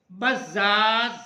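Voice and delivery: "بزاز" drawn out very slowly, every sound held; very slow pronunciation. Shown as a demonstration. Teacher dictating the word to students, very slowly. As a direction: stretch "بزاز" out slowly for the class. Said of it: "یہاں پر اس کا تلفظ لگا دیا گیا ہے".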